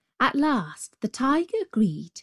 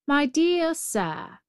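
high, slow, lofty address; condescending road; 7 semitones higher, slower than usual. Acted. Road answering the sad Brahmin, condescending. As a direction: draw it out patronisingly, pitched high.

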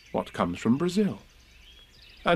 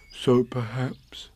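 very slow, mildly sad bear voice; sad musing; 2 semitones higher, much slower than usual. Acted. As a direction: muse slowly, a touch downcast. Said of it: "he said sadly to himself".

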